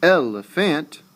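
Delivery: elephant with a heavy final FANT; el-e-FANT, punching the last syllable as well; wrongly stressed. Shown as a demonstration. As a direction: give elephant a full, stressed 'fant' ending. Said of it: "You don't say elephant".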